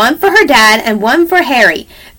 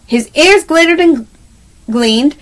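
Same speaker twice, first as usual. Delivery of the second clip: usual speaking voice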